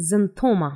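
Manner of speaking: "xanthoma" starting with z. Shown as a demonstration. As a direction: begin "xanthoma" with a z. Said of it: "एक्संथोमा में नहीं पढ़ेंगे". ("xanthoma" ज से)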